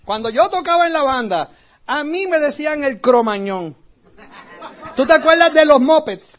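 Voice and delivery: ordinary talk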